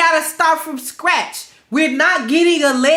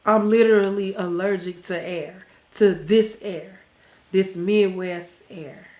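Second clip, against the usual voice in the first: congested; 6 semitones lower, 5 dB quieter than usual. Real, not acted. Streamer explaining allergy to Midwest air, congested. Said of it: "I know I sound really, really s-, congested".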